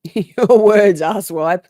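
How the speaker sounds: loud, mocking retort; mock shout. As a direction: throw his words back at him, jeering. Mocking the judge's line at witness.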